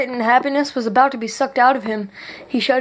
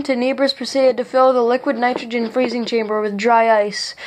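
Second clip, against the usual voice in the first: usual speaking voice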